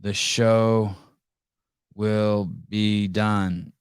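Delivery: very slow, drawn-out ending; dragged out. Real, not acted. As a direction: stretch each word for effect. Dramatic slow repeat.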